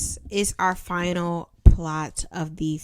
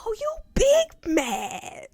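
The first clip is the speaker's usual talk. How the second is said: high, slow, whispered mockery; teasing whisper; 13 semitones higher, slower than usual. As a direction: tease them in a whisper.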